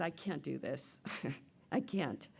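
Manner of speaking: low, soft, with a broken breath; overwhelmed. Acted. Ghost daughter watching her family, quietly overwhelmed. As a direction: give up quietly, acted anguish.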